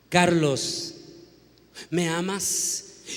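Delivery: low, slow divine voice asking again. Acted. God asks Carlos again, slow and solemn. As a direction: stretch out the question, solemn and slow.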